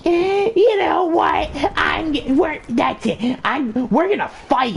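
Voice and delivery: high, slow shrieking angry mom; Karen voice. Retold or imitated. Karen mom confronting the kids, high angry shout. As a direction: play an outraged Karen. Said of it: "literal definition of the word Karen".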